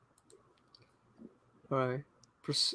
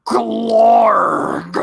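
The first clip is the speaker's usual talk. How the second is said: loud high gurgling creature cry; gurgling alien; 8 semitones higher, 10 dB louder than usual. Pretend. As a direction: belt out a monster gurgle. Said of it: "Can you do the creature sound effect".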